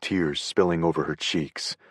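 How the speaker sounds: plain reading voice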